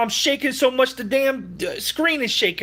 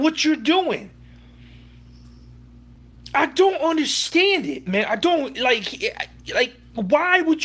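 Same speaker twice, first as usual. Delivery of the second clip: higher, worked-up exasperation; heated disbelief; 7 semitones higher than usual. Real, not acted. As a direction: keep pressing the outrage. Continuing rant about coach.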